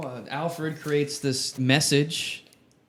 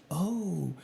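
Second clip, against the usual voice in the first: mock amazement; 5 semitones higher, much slower than usual. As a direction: play it breathy and wide-eyed. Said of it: "She's like".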